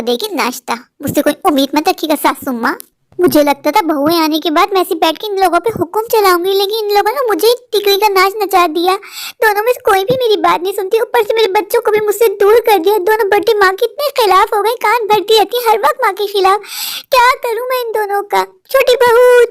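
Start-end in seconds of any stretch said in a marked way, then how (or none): 4.8-18.4 put-on higher, whiny complaining old woman; self-pitying old woman grumbling to herself; whining mother-in-law. 18.7-19.4 high, loud shrill call for the bahu; mother-in-law shouting for her daughter-in-law. Acted. Calling for younger daughter-in-law.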